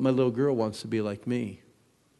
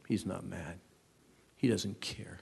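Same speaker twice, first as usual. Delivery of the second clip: soft whispered words; quiet wife; 6 semitones lower, 10 dB quieter than usual. Retold or imitated. Wife at home whispering to her husband, soft and hushed. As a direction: let it drop to a hushed whisper. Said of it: "my wife said".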